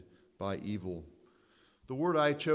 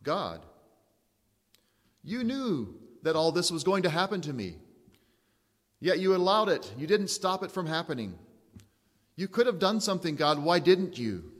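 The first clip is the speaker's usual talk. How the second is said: accusing prayer; 5 semitones higher, 5 dB louder than usual. Retold or imitated. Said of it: "even if we wouldn't say these words".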